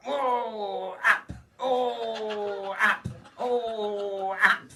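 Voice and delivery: high, hammed-up cries of pleasure through a wall; mock moaning woman. Overheard next door, a comic mock moaning woman. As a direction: ham up a woman moaning in bed.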